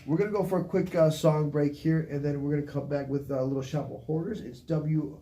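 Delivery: upbeat radio announcer read; radio host. Acted. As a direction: hype up the break like a DJ. Radio station ID break.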